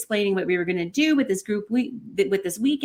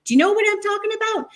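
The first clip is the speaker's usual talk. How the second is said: much higher, loud, fast challenging rant; mock angry tirade; 12 semitones higher, 4 dB louder, faster than usual. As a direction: tear into her like a furious teacher. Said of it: "he lays into her".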